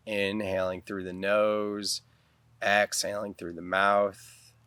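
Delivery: guided meditation. Real, not acted. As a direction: guide them calmly into the breath, unhurried.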